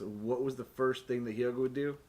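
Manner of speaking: ordinary talk, nothing marked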